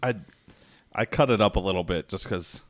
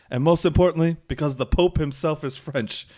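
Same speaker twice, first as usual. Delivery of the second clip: raised, loud, taunting quote; trash-talking Frenchman; 7 semitones higher, 6 dB louder than usual. Retold or imitated. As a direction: imitate a boastful trash-talker, pitched up and louder. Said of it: "all the French guys talking, uh, talking trash".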